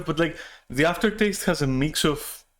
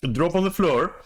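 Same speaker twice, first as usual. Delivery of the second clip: ordinary talk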